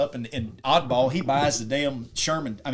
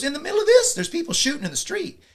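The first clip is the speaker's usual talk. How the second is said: high-pitched retold disbelief, louder at the start; incredulous outburst; 5 dB louder than usual. Bank robber's partner balking at blasting amid gunfire, incredulous. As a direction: exclaim in alarmed disbelief, voice jumping up.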